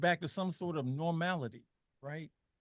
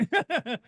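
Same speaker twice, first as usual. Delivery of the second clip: short, higher-pitched laugh; 10 semitones higher, 6 dB louder than usual. Real, not acted. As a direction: break into a brief laugh after making your point. Laughs after his own remark.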